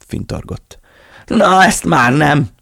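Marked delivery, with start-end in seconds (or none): 1.3-2.5 high, loud, slow trembling yell; shaking Rob roars it with the gun. A narrator's reading. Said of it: "ordította". (reszkető, magas ordítás)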